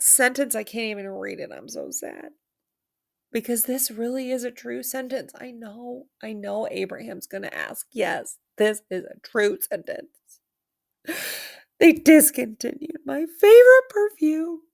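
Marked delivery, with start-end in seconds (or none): 1.5-2.3 a low, soft, sulky moan; mock sad. 11.8-14.6 hammed-up lament, much higher and louder; mock wail. Mock lament over perfume.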